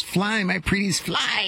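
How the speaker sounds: high-pitched imitation of a yelling witch; Wicked Witch yell. Guest acting out the witch releasing the monkeys, mock yell. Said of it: "And she yells".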